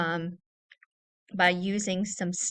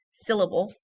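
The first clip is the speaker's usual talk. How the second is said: ordinary delivery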